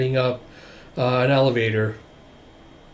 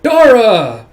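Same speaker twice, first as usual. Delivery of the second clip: loud, high shout of a name; calling out; 9 semitones higher, 10 dB louder than usual. Acted. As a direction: holler the name across the room. Character calls out a name.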